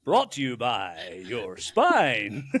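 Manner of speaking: slow, low, swooping sponsor tag; public service announcer. Acted. Spine signing off its announcement, mock announcer voice. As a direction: draw it out like a mock radio sponsor credit. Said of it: "This is a public service announcement".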